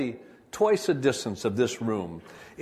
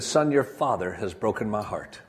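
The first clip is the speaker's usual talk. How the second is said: normal talking voice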